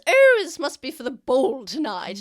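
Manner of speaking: high, loud gushing noble lady; posh noblewoman. Acted. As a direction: gush like a showy high elf lady. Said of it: "And goes".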